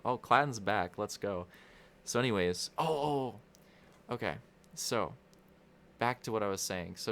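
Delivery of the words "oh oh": ordinary delivery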